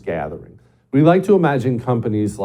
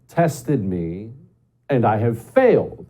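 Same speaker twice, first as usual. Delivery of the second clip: usual speaking voice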